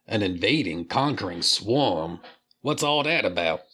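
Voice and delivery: a rough, self-admittedly bad New Jersey accent. Reviewer voicing Cyberfrog with a shaky New Jersey accent. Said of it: "I really suck at doing that kind of accent".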